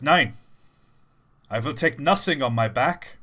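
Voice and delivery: put-on loud, defiant German accent. German prisoner refusing help before his lashes, defiant German accent. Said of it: "And the German says".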